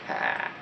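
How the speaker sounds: "cat" with a long open American a; US pronunciation. Shown as a demonstration. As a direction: stretch the American vowel in "cat". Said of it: "U S".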